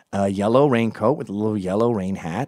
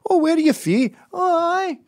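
very high-pitched mock Scottish; Scottish accent; 5 dB louder than usual. Locals questioning an American visitor, high mock Scottish accent. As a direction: squeal it as a caricature Scot. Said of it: "I never use my American accent in Scotland".

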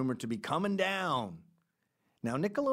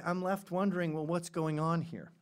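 normal talking voice

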